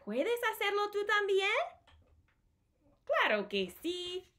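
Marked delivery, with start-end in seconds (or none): 0.1-1.5 put-on high, sing-song question to little kids; high playful read-aloud question; reading to children. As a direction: ask it brightly like a storybook refrain. Book's refrain to kids.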